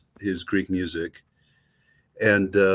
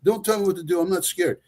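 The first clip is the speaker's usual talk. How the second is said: rushed, higher stubborn refusal; stubborn dad; 9 semitones higher, faster than usual. Retold or imitated. Father refusing son's plea, fast and higher. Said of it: "The guy said".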